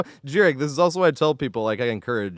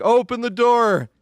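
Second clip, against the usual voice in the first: higher, slow mock shout, calmer than the real one; retold yell; 10 semitones higher, 4 dB louder, slower than usual. Explaining to chat why they yelled, a milder mock shout. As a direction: recreate the yell without full force. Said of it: "But I was like".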